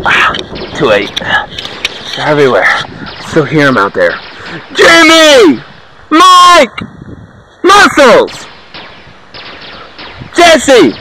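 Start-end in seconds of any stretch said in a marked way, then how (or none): 4.7-10.9 calling lost friends across the forest. Acted. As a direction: shout names to find them. Calling for lost squad mates.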